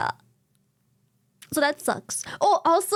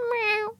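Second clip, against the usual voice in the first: small high-pitched mewl; sad cat meow; 7 semitones higher than usual. Retold or imitated. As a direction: imitate a sad cat meowing. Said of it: "he sounds like the sad cat going".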